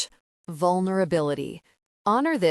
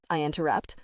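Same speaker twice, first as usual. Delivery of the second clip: usual speaking voice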